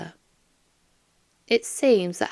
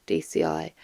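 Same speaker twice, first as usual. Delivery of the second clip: ordinary delivery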